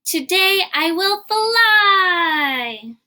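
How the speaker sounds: high, slow, gleeful squeal; excited piggy cry. Acted. Piggy telling Gerald she will fly, high slow excited cry. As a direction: stretch every word out like a thrilled little pig.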